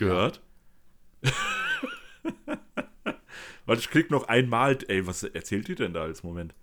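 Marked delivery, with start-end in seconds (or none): 1.2-3.6 bursting into a high laugh; a squealing laugh at the ad; high-pitched laugh. Real, not acted. Laughs at the French ad.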